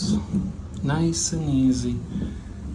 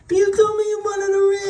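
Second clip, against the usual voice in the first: high fast puppet-like voice, much higher; Sesame Street voice; much faster than usual. Retold or imitated. Boss mimicking the helper as a high fast Sesame Street character. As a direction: rattle it off like a Muppet. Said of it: "He sounded like one of the people on Sesame Street".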